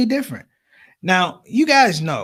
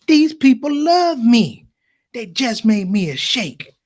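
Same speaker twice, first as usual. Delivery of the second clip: slow, awed and higher; proud whisper-boast; 5 semitones higher, 3 dB louder, slower than usual. Retold or imitated. Friend telling comedian crowd loves him, slow and proud. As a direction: savor the moment.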